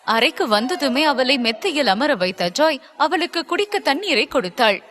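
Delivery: ordinary delivery, nothing marked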